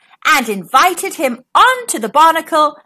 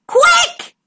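very high urgent shout; captain urging the crew to flee; urgent cry. Acted. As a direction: hurry everyone.

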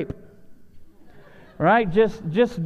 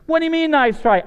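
higher, louder incredulous comeback; indignant retort; 8 semitones higher, 4 dB louder than usual. Retold or imitated. David retorting to the doubters, indignant and louder. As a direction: push back like an offended kid.

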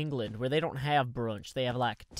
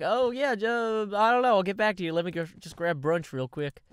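fast, higher, louder breezy brush-off; imitating Generic B; 5 semitones higher, 5 dB louder than usual. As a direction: toss it off like a friend dodging the question. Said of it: "he's like".

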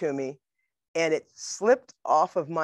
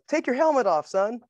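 coach's order; 8 semitones higher, 3 dB louder than usual. Retold or imitated. Coach orders player, retold.

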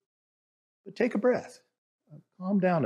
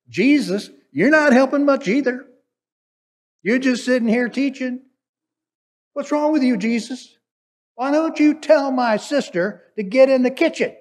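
much higher and louder, whining rebuke; exasperated scolding; 8 semitones higher, 6 dB louder than usual. Acted. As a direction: scold him like a fed-up sister. Martha scolding Jesus in a retelling.

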